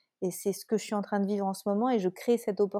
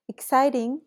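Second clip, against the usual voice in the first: "exciting" said in English, higher and louder; playful English "exciting"; English word; 5 semitones higher, 7 dB louder, slower than usual. Shown as a demonstration. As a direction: toss in "exciting" the American way, bright and loud. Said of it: "comme diraient les Américains".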